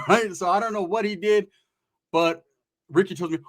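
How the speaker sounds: usual speaking voice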